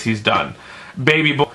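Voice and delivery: normal talking voice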